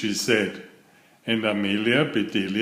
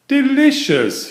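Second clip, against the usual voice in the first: delighted; 14 semitones higher, 6 dB louder than usual. Acted. Father tastes tart.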